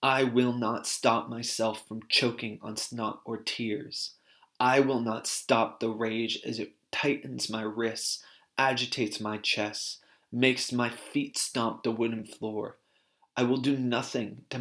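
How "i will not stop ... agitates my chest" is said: plain reading voice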